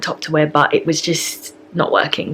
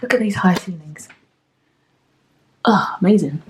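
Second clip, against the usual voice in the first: whispering; 2 semitones higher than usual. Real, not acted. Admiring the high ceilings.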